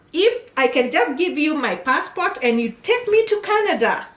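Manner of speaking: mock pleading client, pitched high; lazy applicant. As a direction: imitate someone expecting everything done for them. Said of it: "they want to do the bare minimum".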